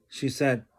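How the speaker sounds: ordinary delivery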